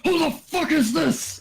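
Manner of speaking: high, slow, angry voice; annoyed god voice. Acted. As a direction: play him offended. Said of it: "I guess he would say".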